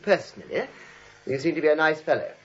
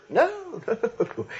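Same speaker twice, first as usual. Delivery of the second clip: laughing through the words at a fool; amused contempt for a silly hero; laughing scorn; 7 semitones higher than usual. Acted. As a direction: chuckle in disbelief. Mocking the foolish play hero.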